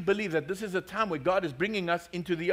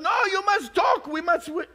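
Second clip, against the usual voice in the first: mock insistent yell; 11 semitones higher than usual. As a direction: scold the customer to make him haggle. Shopkeeper insists on bargaining.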